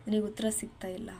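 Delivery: sudden hushed whisper; a preacher's intimate whisper. Acted. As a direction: breathe it to the congregation.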